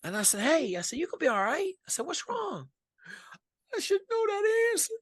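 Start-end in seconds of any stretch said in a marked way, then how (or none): 0.4-2.7 high, soft and tender, coaxing her out of tears; gentle reassurance. Retold or imitated. Consoling the crying interviewee, high tender voice. Comforting a crying job candidate. 3.7-4.9 much higher, whimpering mock-cry; a quavering, teary voice of a young woman holding back sobs; imitating her tearful reply. The interviewee crying over the calculus question, mock teary whimper.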